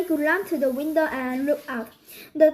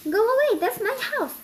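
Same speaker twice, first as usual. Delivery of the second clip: shouting; 8 semitones higher, faster than usual. A narrator's reading. Man shouting at ducks.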